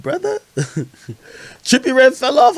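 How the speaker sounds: ordinary talk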